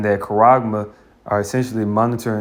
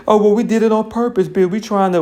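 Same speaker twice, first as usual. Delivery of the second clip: higher, whiny excuse-maker voice; mock excuse; 10 semitones higher than usual. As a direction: parody a lame excuse. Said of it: "Then they say".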